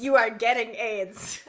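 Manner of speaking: loud, slow, overblown pronouncement; hammy doom-laden warning; mock melodrama. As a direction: drag it out like a soap opera curse. Mocking the bad line.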